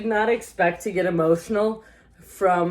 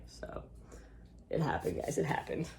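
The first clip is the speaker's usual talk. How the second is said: hushed confession; 4 semitones lower, 14 dB quieter than usual. Real, not acted.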